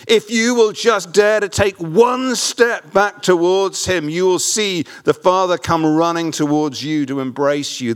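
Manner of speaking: slow, high-pitched, emphatic sermon appeal; fervent closing appeal to the congregation; impassioned preaching. Real, not acted. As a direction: preach it slowly, leaning hard on key words.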